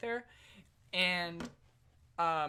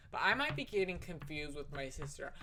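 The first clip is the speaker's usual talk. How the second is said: ordinary talk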